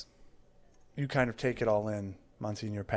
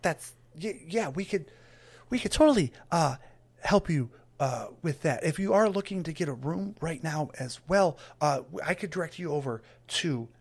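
higher, stammering, nervous helper; flustered Trevor; 6 semitones higher than usual. Acted. Trevor flustered by the huge cleric, higher and stammering. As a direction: babble nervously up in pitch.

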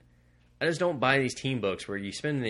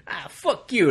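brash Jersey tough guy, higher and louder; 8 semitones higher, 6 dB louder, slower than usual. Retold or imitated. Comic fan voicing Namor as a Jersey guy, brash Jersey accent. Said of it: "that's how I picture Namor talking".

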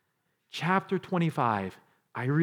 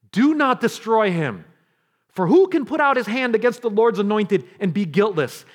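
loud, raised, commanding voice; David's firm command; 7 semitones higher, 8 dB louder than usual. Acted. David forbidding Abishai to kill Saul, loud and firm. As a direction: forbid it firmly, projecting. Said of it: "the difference in David's voice here".

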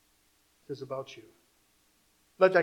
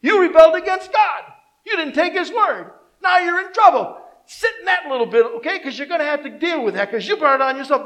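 louder, higher accusing preacher voice; scolding judgement; 10 semitones higher, 12 dB louder than usual. Acted. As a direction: lay into them like they had it coming. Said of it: "And you would say".

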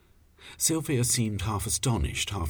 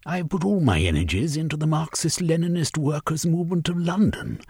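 raised, quick woman's voice; Sylvia's voice; 10 semitones higher, faster than usual. Acted. Fiery leader Sylvia addressing the group, pitched high and quick.

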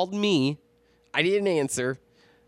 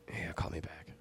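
very soft and low, after a pause; hushed sign-off; 7 semitones lower, 15 dB quieter than usual. Retold or imitated. Friend ending a grim voicemail, hushed. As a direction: trail out quietly, deflated.

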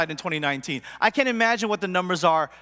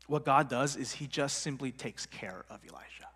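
whispered, much softer aside; hushed; 7 semitones lower, 11 dB quieter than usual. Real, not acted. Pastor whispering to the congregation how God cares for Elijah. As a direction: drop to a whisper to let it land. Pastor hushing the key point.

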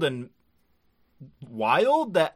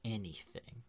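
suddenly grave, much softer and lower; dead serious mechanic; 6 semitones lower, 16 dB quieter than usual. Retold or imitated. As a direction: drop into a hushed, dead-serious anime hero. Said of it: "he's doing the thing where he's very serious now".

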